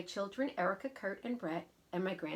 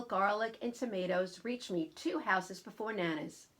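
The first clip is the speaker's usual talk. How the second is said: ordinary delivery, nothing marked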